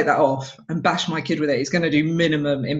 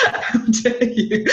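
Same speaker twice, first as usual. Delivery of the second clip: laughing mock outrage; 6 semitones higher, much slower than usual. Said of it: "It's like".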